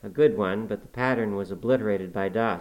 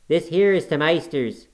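put-on rough, louder dialect voice of an old servant; gruff Yorkshire servant; 7 semitones higher, 7 dB louder than usual. A narrator's reading. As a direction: grumble it like a surly farmhand. Joseph's dialect voice.

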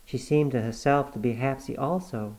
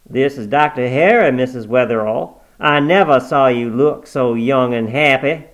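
louder, jovial bedside doctor; hearty doctor; 9 dB louder than usual. Acted. Doctor Harry cheerfully greeting his old patient, hearty.

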